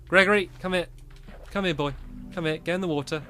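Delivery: calling a dog. Real, not acted.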